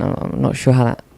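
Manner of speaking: ordinary talk, nothing marked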